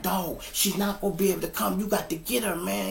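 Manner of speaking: high-pitched, pleading argument; retold arguing. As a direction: argue urgently to make him act. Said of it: "uh, arguing".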